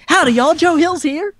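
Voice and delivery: very high, hammed-up American twang greeting; joyful self-parody accent. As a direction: greet the fans as a gleeful caricature of your own accent. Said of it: "I should enjoy it more".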